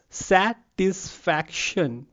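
very slow "satisfaction" as sa-tis-fac-tion; syllable by syllable. Shown as a demonstration. Teacher asking class to count syllables, slowly syllable by syllable. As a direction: break "satisfaction" into four spaced beats. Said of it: "how many syllables it has".

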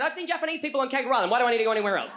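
high, fast, loud retort from Dad; exasperated dad. Retold or imitated. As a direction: rattle it off as a baffled dad. Dad's exasperated retort, quoted.

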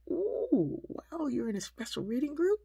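high, loud ooh sliding into wonder; big impressed "ooh, wow"; impressed parent reacting. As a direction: play up amazement for a small child. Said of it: "like, excited about it".

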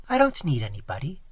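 usual speaking voice

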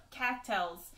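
usual speaking voice

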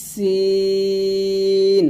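সিন with a long hissed s and long i; sharp s, not sh, hold the vowel of সিন; drawn-out Arabic sin. Shown as a demonstration. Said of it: "পাতলা হবে". (সিন পাতলা করে টেনে)

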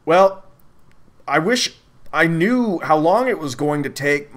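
ordinary delivery

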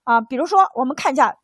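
usual speaking voice

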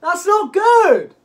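high, loud, stretched-out wail; dismayed shout. Real, not acted. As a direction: wail it out as the shot goes wrong. Dismayed at bad shot.

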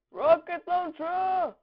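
high, loud weapon call; battle cry. Pretend. Gamer announcing the rocket launcher, battle cry.

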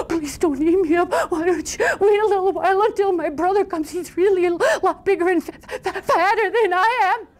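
gasping, high, trembly begging; pleading little goat. Acted. Tiniest goat begging the troll to wait, scared high stammer. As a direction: beg for your life in a tiny high voice.

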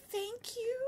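high, uncertain thank-you; bemused thanks. Retold or imitated. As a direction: imitate a puzzled, polite reply. Retold awkward reply to compliment.

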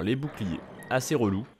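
ordinary talk, nothing marked

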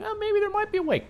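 quick, high little thinking voice; musing inner thought. Acted. Game master voicing Lars inspecting the crystal, high musing voice. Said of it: "inspecting it and thinking".

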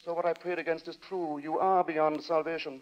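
stern pious lodger. Acted. Lodger quoting scripture, condemning Daisy.

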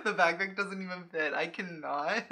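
helpless at the failure; laughing. Real, not acted. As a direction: still giggling as you say it. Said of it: "I'm, like, literally crying".

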